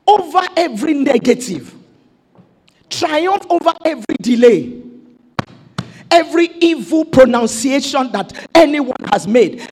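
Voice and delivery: usual speaking voice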